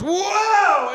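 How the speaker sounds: high startled whoa; surprised whoa. Retold or imitated. Girlfriend startled when he touches the spot. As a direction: yelp it, caught off guard. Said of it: "she just goes".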